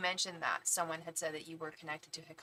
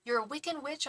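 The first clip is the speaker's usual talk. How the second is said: higher-pitched, questioning mimicry; imitating wedding guests; 8 semitones higher than usual. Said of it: "Everybody at the wedding was like".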